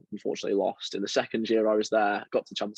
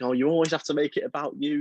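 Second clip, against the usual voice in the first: mock exasperated yell, raised in pitch; mild retold scream; 6 semitones higher than usual. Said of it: "screaming at myself".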